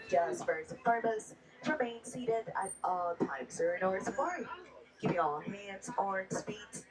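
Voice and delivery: upbeat tour guide patter over the music; brisk, cheerful safety spiel for the riders; hushed excited safari guide. Acted.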